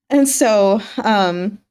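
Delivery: ordinary talk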